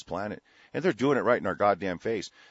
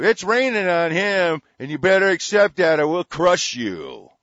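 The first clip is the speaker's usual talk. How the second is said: hammed-up bully voice, louder and higher; loud mock threat; 8 semitones higher, 9 dB louder than usual. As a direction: bark it like a bully giving orders.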